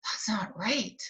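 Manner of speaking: hushed whisper of disbelief. Retold or imitated. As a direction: whisper it like a worried friend. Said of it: "she's like".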